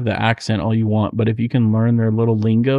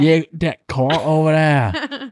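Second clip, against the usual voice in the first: slow, higher-pitched accented host impression; Ian; 5 semitones higher, slower than usual. Echoing the Love Island host, slow put-on accent. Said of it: "what's his name from Love Island".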